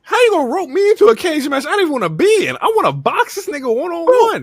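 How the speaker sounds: very high-pitched indignant protest; mock outrage. Wrestler protests being roped into a cage match, mock outrage. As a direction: squawk it as an acted complaint.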